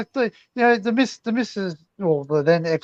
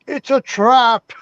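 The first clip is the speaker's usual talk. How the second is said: alien quote; 5 semitones higher, 5 dB louder, slower than usual. Retold or imitated. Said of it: "alien goes".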